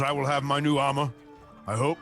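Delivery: ordinary talk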